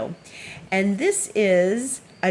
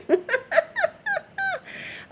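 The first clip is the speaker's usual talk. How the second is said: squealing burst of laughter; high shrieking laugh. Real, not acted. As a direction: let out a high helpless laugh. Laughing at the cat.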